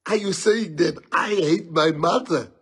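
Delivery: loud, wounded, hammed-up protest; comic fake hurt in an overblown voice; mock emotional outrage. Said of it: "I'm even getting emotional".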